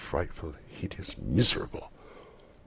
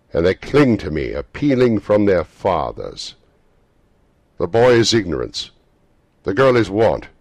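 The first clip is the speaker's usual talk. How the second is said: grave spirit; 5 semitones higher, 13 dB louder than usual. Acted. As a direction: pronounce it gravely. Spirit warning Scrooge.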